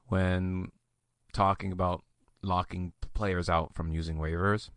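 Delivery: low, soft creaky voice; vocal fry growl. Shown as a demonstration. Host on locking players out of waivers, in a low vocal fry. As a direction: let it sink into a quiet, low growl. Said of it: "I did that thing".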